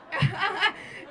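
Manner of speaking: short real laugh. Real, not acted. Speaker after her comeback to the judge, laughing.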